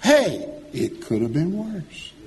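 a hint of the old aunt, drawn out slowly; lightly voiced auntie, slow and sing-song; slow old-lady catchphrase, lightly voiced; old aunt's catchphrase. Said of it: "she would always say to people".

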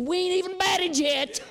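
put-on slow, high, cheerful boy's punchline; lightly voiced happy boy; kid's voice. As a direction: deliver it like an upbeat little leaguer.

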